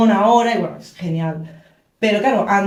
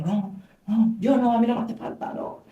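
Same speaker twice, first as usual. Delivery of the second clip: retold tearful refusal; 6 dB quieter than usual. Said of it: "y yo".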